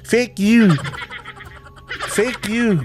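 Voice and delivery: high mock taunt, a bit hammed-up; mocking the congressman. Vlogger mocks congressman jeering at them, hammed-up taunt. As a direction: imitate him jeering, lightly voiced. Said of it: "Gumaganong-ganon pa".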